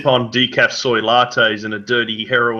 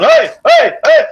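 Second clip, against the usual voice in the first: high, loud mock cheering shout; imitated party shout; 13 semitones higher, 10 dB louder than usual. Mocking Melbourne diners clapping and smashing plates, loud high shouted cheer. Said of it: "clap their hands going".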